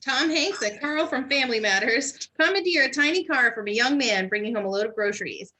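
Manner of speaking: ordinary talk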